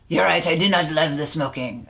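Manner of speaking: hammed-up French admission; bad French accent. French client conceding to the coach, bad French accent. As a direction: imitate a Frenchman conceding, thick mock accent. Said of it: "bad French accent".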